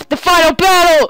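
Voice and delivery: high, loud battle cry; excited shout. Real, not acted. Player hyping up the last fight, excited high shout. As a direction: announce the final battle at full voice.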